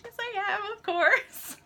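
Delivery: very high, giddy acceptance; retold eager fangirling. Fan asked if she's coming tonight, high excited reply. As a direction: replay a giddy fan's squeaky yes. Fan's eager answer, acted out.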